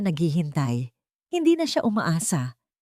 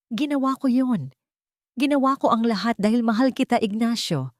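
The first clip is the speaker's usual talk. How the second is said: higher, voice changed, crying out the confession; sobbing confession; 5 semitones higher than usual. A narrator's reading. Beatrice confessing to Ignacio, crying out through sobs. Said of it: "Sigaw niya sa gitna ng kanyang mga hikbi".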